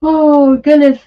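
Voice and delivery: high, loud words stretched through a yawn. Real, not acted. Streamer yawning to viewers while chatting about prices. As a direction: say it mid-yawn. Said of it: "I don't know why I'm yawning".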